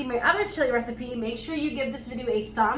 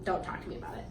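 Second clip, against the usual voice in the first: whispered; 4 semitones lower, 12 dB quieter, much faster than usual. Real, not acted. Sassy aside to critics.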